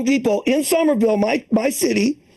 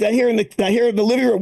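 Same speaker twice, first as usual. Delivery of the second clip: ordinary delivery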